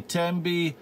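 usual speaking voice

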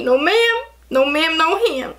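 high, playful sassy no ma'am; sing-song sassy dismissal; teasing diva comeback. Acted. Sassy refusal continues.

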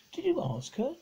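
a girl's mock scorn at her brother; scornful. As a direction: sneer the question at him. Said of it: "said Roberta with scorn".

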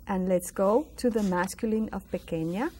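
ordinary talk, nothing marked

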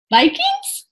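very high, rising echo; startled question. A narrator's reading. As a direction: squeak it back in disbelief. Annie echoes the alarm, startled.

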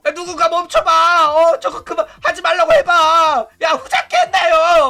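high, loud begging for someone to step in; panicked mock plea. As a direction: cry out to stop it, hammed up. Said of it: "막 이러면서". (높고 크게 말려달라는 다급한 흉내)

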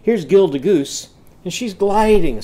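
normal talking voice

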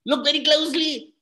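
"Look very closely" pitched well up, quick and urgent; high urgent Kalam. Acted. Kalam urges the officers to look, high and quick. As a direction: snap it out high and fast, with no pause. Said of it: "And Abdul Kalam said".

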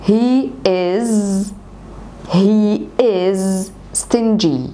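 very slow, split into chunks; slow repeat-after-me. Shown as a demonstration. Teacher breaking the sentence up for students, slow and deliberate. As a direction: model it piece by piece for copying. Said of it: "عايزاك تقول ورايا".

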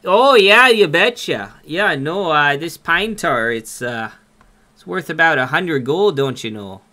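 hammed-up Minnesotan accent, loud at the start. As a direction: play up a folksy Minnesota accent on request. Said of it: "Can you talk Minnesotan?".

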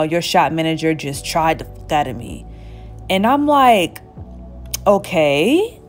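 ordinary talk

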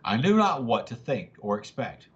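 ordinary delivery, nothing marked

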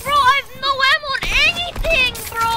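high, fast panicked rant; panicking gamer. Real, not acted. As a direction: blurt it out in rising panic. Out of ammo in firefight.

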